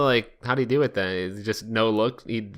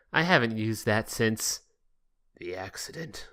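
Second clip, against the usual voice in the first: ominous hint; 3 semitones lower, 8 dB quieter than usual. Shown as a demonstration. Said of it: "it's a little more like".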